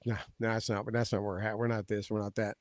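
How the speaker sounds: quiet, low-key retold objection; lightly acted quiet pushback; imitating muted disagreement. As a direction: softly voice a mock protest under the breath. Retelling Powell disagreeing beside the president.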